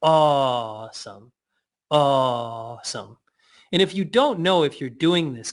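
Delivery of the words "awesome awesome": awesome stretched slowly and low; slow low awesome in awe; slow drawn awesome. Shown as a demonstration. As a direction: linger on awesome in wonder.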